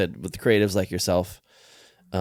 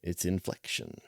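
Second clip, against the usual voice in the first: "inflection" said low, slow and soft; hushed, drawn-out low reading of "inflection"; 11 dB quieter, slower than usual. Shown as a demonstration. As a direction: take "inflection" down low and slowly. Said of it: "It's just inflection".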